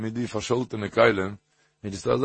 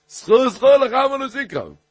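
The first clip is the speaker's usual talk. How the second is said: high, loud, forceful warning; emphatic corrected reading; 13 semitones higher, 7 dB louder, much faster than usual. Shown as a demonstration. Teacher showing how the warning to the nazir goes, emphatic. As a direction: call it out high, hammering the repeated word. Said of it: "זי גייט נישט אזוי".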